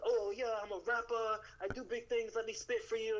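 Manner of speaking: imitating a cocky Italian rapper; mock Italian wannabe rapper. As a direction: puff up like a bragging street rapper. Italian guys bragging, mocked.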